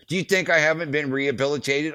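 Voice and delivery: high, indignant challenge; fired up. Retold or imitated. Roadie challenging the border cop over old arrest, fired up. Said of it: "get all fired up".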